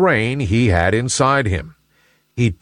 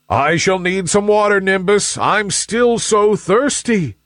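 high, needy plea; pitched-up, slowing appeal for water; thirsty sun; 9 semitones higher than usual. A narrator's reading. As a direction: draw out the thirst at the end. Sun begs for more water.

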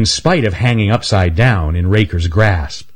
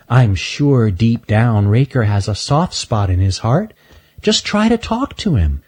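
put-on young girl voice; 3 semitones higher than usual. Shelby speaking.

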